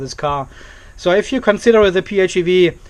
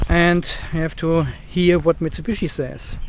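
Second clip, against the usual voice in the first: ordinary delivery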